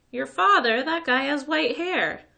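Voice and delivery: loud, high, slow disbelief; can't believe it. A narrator's reading. Disbelief at old father.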